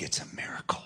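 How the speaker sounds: whispered awe; 1 hushed. Retold or imitated. Parents over a newborn, a hushed whisper. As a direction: breathe it out softly in wonder.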